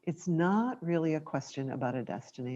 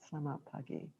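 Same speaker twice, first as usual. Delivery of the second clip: much softer, hushed greeting; quiet presence; 13 dB quieter, much faster than usual. Shown as a demonstration. Greeting a neighbour gently on the morning walk, hushed. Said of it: "a quiet presence about".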